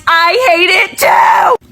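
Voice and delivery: high, slow, exasperated cry; real exasperated outburst; exasperated shout. Real, not acted. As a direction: wail it in mock despair. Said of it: "mock confession, exasperated".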